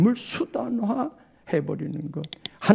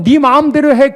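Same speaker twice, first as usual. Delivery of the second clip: higher and louder, fed-up snap; exasperated mother; 5 semitones higher, 12 dB louder than usual. Retold or imitated. Mother gives up on her stubborn child, shouting. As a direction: throw up your hands at the child.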